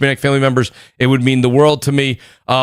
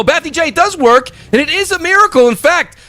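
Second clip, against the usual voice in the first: excited; 12 semitones higher than usual. Real, not acted. Host hyping super chat.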